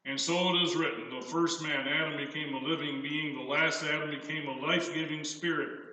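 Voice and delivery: measured pulpit reading of the verse; scripture reading. Acted. Preacher reading the Bible passage aloud, measured. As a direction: lay each phrase out for the congregation.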